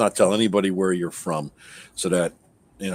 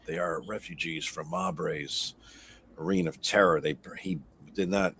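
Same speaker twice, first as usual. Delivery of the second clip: soft, low hushed aside; a quiet secret for his ears only; whisper; 5 semitones lower, 6 dB quieter than usual. Acted. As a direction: lean in and murmur it quietly. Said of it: "I whisper to him".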